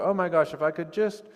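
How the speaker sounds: a touch of breathless wanting; longing excitement. Retold or imitated. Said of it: "you go".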